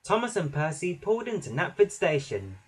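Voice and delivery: usual speaking voice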